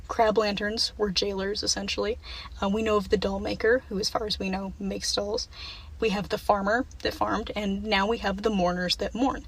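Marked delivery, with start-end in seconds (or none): none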